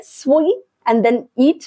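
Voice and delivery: sweet and then eat said very slowly, word by word; slow demo. Shown as a demonstration. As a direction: spell it out slowly for learners. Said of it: "दो टुकड़े बन रहे हैं".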